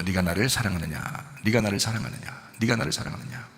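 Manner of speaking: low, soft imitation of the Lord; gently retold question. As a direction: ask it quietly, voicing the Lord to Peter. Voices the Lord questioning Peter.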